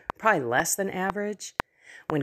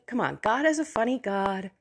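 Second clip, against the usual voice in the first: ordinary talk, nothing marked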